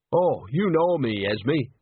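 good-natured and untroubled; mildly jolly old friend; cheerful. A narrator's reading. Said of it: "said Nanny cheerfully".

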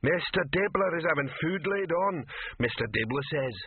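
lightly voiced troll messenger. A narrator's reading. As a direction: recite the boss's words as a ponderous troll. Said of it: "said Detritus".

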